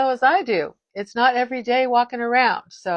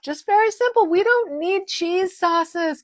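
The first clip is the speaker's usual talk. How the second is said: mock high voice; 10 semitones higher than usual. Mocking cheese sauces.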